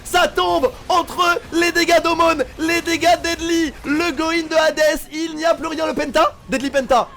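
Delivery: loud, high pentakill call; esports caster. Real, not acted. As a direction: scream the play like a live caster. Caster calls pentakill live.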